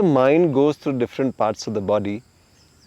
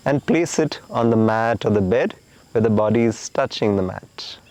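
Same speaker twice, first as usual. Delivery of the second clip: guided relaxation. Acted. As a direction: talk them gently into letting go.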